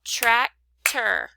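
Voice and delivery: "Tractor" split into trac and tor, punched; two loud separate beats on "Tractor"; clapped-out syllables. Shown as a demonstration. Teacher clapping for pupils, "Tractor" in two separated beats. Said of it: "if we clap it out".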